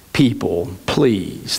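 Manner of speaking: understated, low-pitched plea; a mild, narrated entreaty; pleading. A narrator's reading. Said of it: "they begged".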